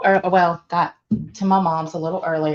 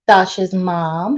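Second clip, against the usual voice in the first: normal talking voice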